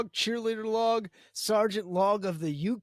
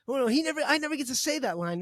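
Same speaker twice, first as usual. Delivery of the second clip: higher, fast whiny protest; whiny complaint; 6 semitones higher, much faster than usual. Retold or imitated. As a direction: protest it in a lightly voiced whine. Retold argument, character complaining.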